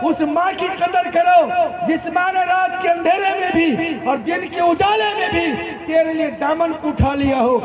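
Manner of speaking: high, driving sermon delivery, phrase after phrase; impassioned preaching. Acted. Preacher urging the young to value their parents, impassioned.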